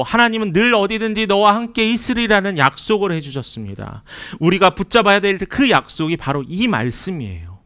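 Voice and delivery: 힘주어 외치듯 설교하기; impassioned preaching. Acted. Preaches at full force. (회중을 향해 목소리를 높여 외치는 설교)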